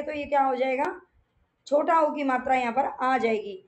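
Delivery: ordinary talk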